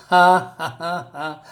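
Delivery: hammed-up evil cackle starting loud; mock villain laugh. Reading the comic, a mock villain laugh. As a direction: cackle like a comic-book crook. Villain gets his comeuppance.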